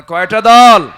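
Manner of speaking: loud preacher's shouted question; loud shout on request. Acted. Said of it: "আসতে বলবেন না".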